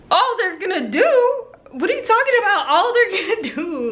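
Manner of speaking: high incredulous squeal, laughing at the end; can't believe what she heard; outraged disbelief. Retold or imitated. Outraged retort to dog owner.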